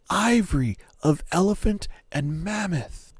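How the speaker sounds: ordinary delivery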